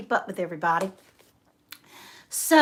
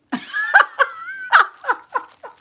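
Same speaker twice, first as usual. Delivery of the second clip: high laugh; 6 dB louder than usual. Real, not acted. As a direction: burst of high, loud laughter. Laughs at her tablecloth mix-up.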